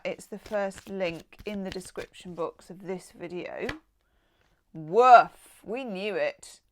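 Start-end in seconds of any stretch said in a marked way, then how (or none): 4.7-5.6 loud, punchy "Woof" falling off; woof exclamation. Real, not acted. Tarot reader reacting to a hot card, loud woof. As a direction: blurt out an impressed woof. Reacting to fiery love cards.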